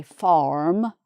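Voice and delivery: "farm" in an American accent with the R sounded; put-on American "farm" with rhotic R. Shown as a demonstration. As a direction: demonstrate American "farm", strong R before the M. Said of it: "an American might say".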